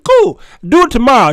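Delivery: eager. Retold or imitated. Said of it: "I said".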